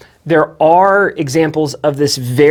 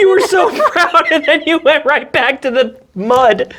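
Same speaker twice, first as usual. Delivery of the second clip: high words broken by laughter; laughing; 11 semitones higher than usual. Real, not acted. Co-host teasing her relapse, laughing as she's mocked. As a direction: tease through real laughter.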